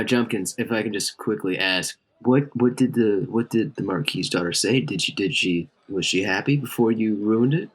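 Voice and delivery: normal talking voice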